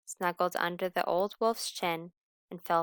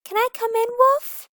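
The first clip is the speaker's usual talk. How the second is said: loud high plea; pleading call; 13 dB louder than usual. Acted. Lamb locked out begs, loud and high. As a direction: beg to be let in, high.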